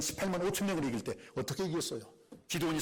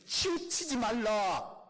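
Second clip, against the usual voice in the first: high, slow commanding decree; solemn divine command; voice of God; 10 semitones higher, 3 dB louder, much slower than usual. Acted. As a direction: proclaim it like God's command. Quoting God's command.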